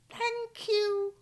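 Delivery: very high tiny mouse voices; squeaked. Acted. The mice thank George for the shoe, squeaky. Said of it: "they squeaked".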